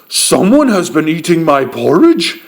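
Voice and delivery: great big bear voice; roaring Papa Bear. A narrator's reading. Papa Bear finds his porridge was eaten, roaring. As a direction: roar it as a big angry bear. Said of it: "he roared in his great big voice".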